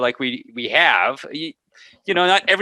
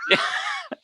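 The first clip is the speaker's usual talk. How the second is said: high burst of laughter; 6 dB quieter than usual. Real, not acted. Cohost laughing at a teasing joke, high burst of laughter. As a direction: crack up in a high squeal.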